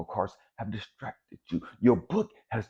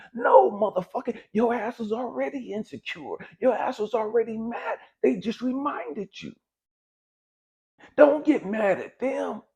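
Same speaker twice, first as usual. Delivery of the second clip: angry callout; 10 semitones higher than usual. Real, not acted. Preacher scolding the listener, higher and heated. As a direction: call them out hard. Calling out the audience angrily.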